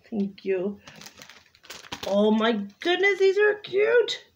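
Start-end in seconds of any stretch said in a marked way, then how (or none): 2.1-4.2 delighted squeal. Real, not acted. Delighted reaction to stickers.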